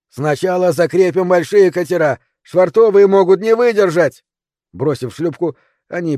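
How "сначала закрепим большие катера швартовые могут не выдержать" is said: loud, high commanding shout. Acted. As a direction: bark orders over a roaring storm. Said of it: "прокричал Герлиц". (громкий приказной крик)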